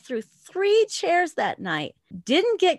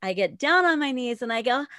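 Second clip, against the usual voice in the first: normal talking voice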